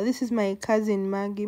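normal talking voice

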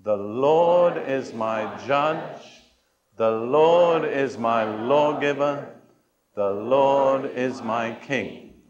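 slow and deliberate, each word weighed; slow personal vow. Shown as a demonstration. Preacher and congregation reciting together, slow solemn vow. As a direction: say it slowly like an oath in court. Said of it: "shall we say that together?".

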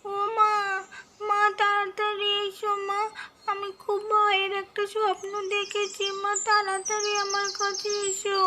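put-on small scared kid crying out for her mother; Mahi. Child calling out to her missing mother after a nightmare.

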